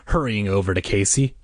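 usual speaking voice

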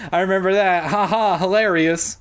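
loud, high fake laugh and remark; exaggerated mock amusement. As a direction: pitch it up with a put-on ha ha. Sheena's mocking reply to the story.

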